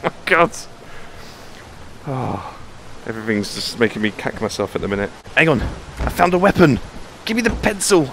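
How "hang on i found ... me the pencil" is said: pleased to finally have a weapon; excited. Real, not acted. Player grabbing a pencil as a weapon, excited.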